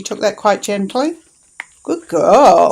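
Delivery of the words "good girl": loud, happy praise for the dog; excited praise. Real, not acted. Owner praising her dog after a gentle take, loud and happy. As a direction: cheer her on for a good take.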